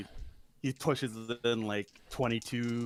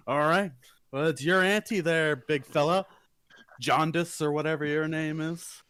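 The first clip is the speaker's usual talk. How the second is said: a touch louder, higher taunting tone; mild cocky gambler; 5 semitones higher, 5 dB louder than usual. Acted. Player's character taunts the card opponent, lightly smug. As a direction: needle the big fella. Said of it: "I was like".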